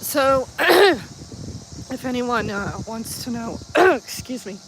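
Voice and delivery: throat catching, clearing mid-sentence; choking on spit. Real, not acted. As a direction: talk through a tickle in the throat. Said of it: "I'm choking on my own spit".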